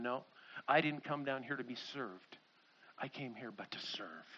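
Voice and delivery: low, hushed, gentle insistence; humble refusal. Acted. Jesus tells his followers he came to serve, humble hush. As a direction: drop right down, soft and unassuming.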